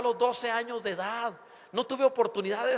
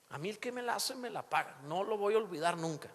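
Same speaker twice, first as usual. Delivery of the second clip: grudge-holder's voice; 3 semitones lower, 7 dB quieter than usual. Retold or imitated. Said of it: "Algunos sean bien rencorosos".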